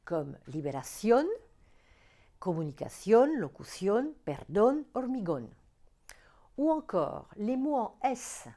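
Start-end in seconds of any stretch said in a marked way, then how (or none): 0.5-1.2 liberación stressed on CIÓN; "liberación" rising to a strong last syllable. Shown as a demonstration. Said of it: "l'accent tonique s'entend sur la dernière syllabe". 2.4-5.5 comunicación, locución, perdón, hormigón stressed on the final ón; word list, comunicación to hormigón, each end-stressed; stress on last syllable. Shown as a demonstration. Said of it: "l'accent tonique s'entend sur la dernière syllabe".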